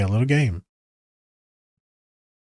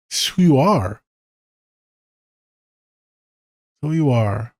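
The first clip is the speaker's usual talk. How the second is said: tearing up; slower than usual. Real, not acted. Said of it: "Like crying again".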